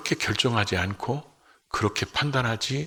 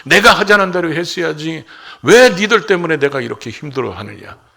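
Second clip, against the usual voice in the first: very loud, raised-pitch acted blame; loud reproach, easing in pitch toward the end; resentful complaint; 6 semitones higher, 11 dB louder than usual. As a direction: hurl the blame at them, playing the aggrieved man. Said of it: "다 원망 불평하면서".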